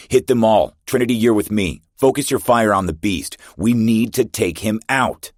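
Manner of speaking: rally the squad at full voice; shouted orders. Acted. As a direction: commander yelling orders mid-firefight. Commander shouting orders in battle.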